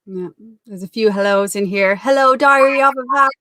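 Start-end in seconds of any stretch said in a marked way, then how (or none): 1.9-3.3 high, loud radio-presenter hello; big enthusiastic shout-out; loud greeting. Real, not acted. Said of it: "You're very loud".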